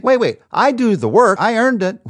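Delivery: loud, high-pitched protest; protesting objector. Acted. Preacher voicing the listener's objection, loud protest. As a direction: object indignantly, punching each claim. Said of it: "I know you think".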